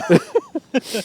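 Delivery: high giggling burst; burst of laughter. Real, not acted. Laughing at the running gag.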